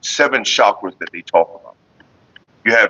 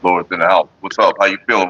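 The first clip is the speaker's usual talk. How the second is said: ordinary talk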